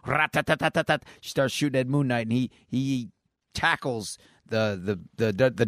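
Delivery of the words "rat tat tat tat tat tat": high, rapid-fire rat-a-tat; imitated machine-gun fire. Recapper shows her shooting at Moon Knight, mimicked gunfire. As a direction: voice the gun's burst as staccato syllables. Imitates AK forty-seven firing.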